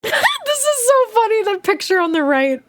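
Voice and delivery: high, squealing through real laughter; helpless amused delivery, settling as it ends; laughing. Real, not acted. Cracking up at the picture.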